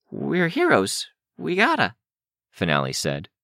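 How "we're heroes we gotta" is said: put-on high, nervous young voice; scared but determined, high voice; terrified. A narrator's reading. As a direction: insist through fear, pitched high. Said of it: "She looked terrified".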